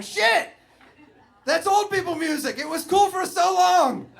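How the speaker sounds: high, loud panicked outburst; sudden horror at being old; loud high shocked swear; dismayed shock. Retold or imitated. Realizing he's uncool.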